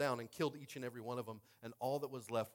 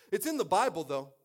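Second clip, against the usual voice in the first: loud, raised-pitch protest; emphatic defence; 5 semitones higher, 10 dB louder than usual. Real, not acted. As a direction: burst out in self-justification. Loud defence, later repeated plainly.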